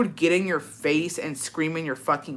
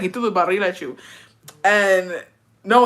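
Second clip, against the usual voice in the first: ordinary talk, nothing marked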